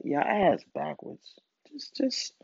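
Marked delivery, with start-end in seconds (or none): 1.7-2.3 whisper. Real, not acted. Exasperated aside.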